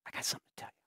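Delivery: secretive hushed whisper. Retold or imitated. Sick girl confiding in her pastor, secret whisper.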